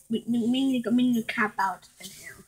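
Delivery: hushed whisper. Pretend. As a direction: whisper so the dogs won't hear.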